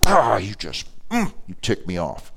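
mock frustrated growl at someone close; pent-up exasperation. Annoyed person to spouse or friend, exasperated. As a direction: act fed up, holding it back.

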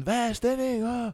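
mock the crowd's eager greeting; hyped crowd. Friend imitating people greeting him at the party, hyped. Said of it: "der var sådan".

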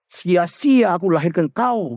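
high, exasperated mock mother scolding; mother disowning her child in mock despair. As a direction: whine it out as a fed-up mother.

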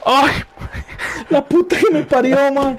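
high, strained curse of pain; pained swearing. Real, not acted. Cramp grabs again, he curses in high strained pain.

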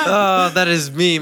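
laughing through the words; giggling embarrassed admission. Real, not acted.

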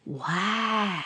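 long, slow bear "wow"; marveling bear. Acted. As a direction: let the wonder ooze out slowly.

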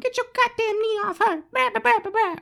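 very high squeaky imitation of screaming; mock screaming. Mocking the woman screaming in the background, squeaky mock scream. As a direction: mimic her in a piercing falsetto without yelling. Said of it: "she's screaming in the background".

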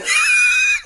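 very high, loud squeal; mock shriek of laughter; imitated squealing laugh. Imitating classmate looking at me, squealing laugh. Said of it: "he looks at me like".

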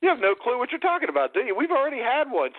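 high-pitched, indignant protest; exasperated disbelief. Real, not acted. Host correcting his co-host about a past guest, exasperated. Squabble over having had a guest.

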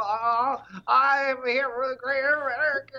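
hammed-up tearful politician wail; imitated blubbering speech; mock crying. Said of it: "sound like they're crying".

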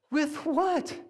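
high-pitched, incredulous retort; exasperated question. Retold or imitated. Dad told to scoop out the pool, acted exasperated. Dad argues back at wife.

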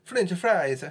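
high, sing-song mock Italian accent. Player protesting the rule to a friend in a mock Italian accent. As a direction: put on a hammy Italian accent, pitched well up. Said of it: "speak with an Italian accent".

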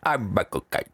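Michael Caine impression. Shown as a demonstration. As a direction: ramble like a lazy Caine. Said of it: "that's all we ever did with our impression".

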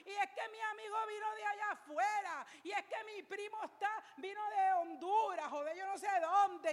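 high, loud preacher's rebuke; fiery sermon cadence; preaching. Acted. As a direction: rail at the excuses from the pulpit. Preaching at full force.

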